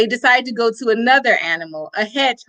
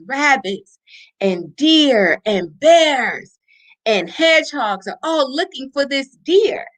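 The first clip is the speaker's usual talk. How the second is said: usual speaking voice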